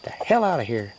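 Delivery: a lightly voiced, sassy little chicken guarding its food; voicing a chicken. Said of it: "this little dude's like".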